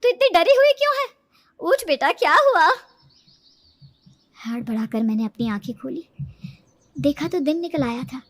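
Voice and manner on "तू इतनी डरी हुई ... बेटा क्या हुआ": louder, concerned mother calling; mother waking her daughter from a nightmare, worried. A narrator's reading. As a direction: shake her awake, anxious and caring.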